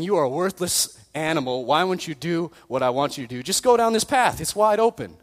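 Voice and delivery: exasperated scolding. Acted. Voices Balaam berating his disobedient donkey.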